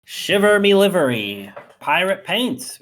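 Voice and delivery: high, loud pirate exclamation. Pretend. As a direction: ham up a pirate. Pirate week announcement.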